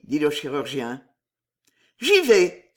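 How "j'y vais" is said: sudden loud, higher cry; exclaiming. A narrator's reading. Thérèse resolving to go to Michel, exclaiming. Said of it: "s'écria Thérèse".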